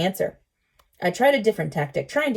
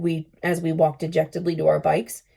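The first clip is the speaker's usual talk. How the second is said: ordinary delivery, nothing marked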